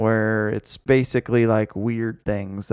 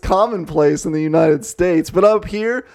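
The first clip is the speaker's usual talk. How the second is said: high, louder put-on voice of a haughty neighbor; mock pompous Canadian; 5 semitones higher, 5 dB louder than usual. Cohost parodies the Canadian neighbor lecturing the States, high and loud.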